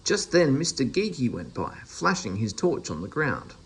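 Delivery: usual speaking voice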